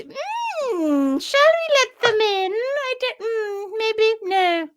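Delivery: high, drawn-out pretend dithering; put-on sing-song pondering; mock deliberation. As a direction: hum and haw theatrically in a high voice. Acting out deciding on member requests.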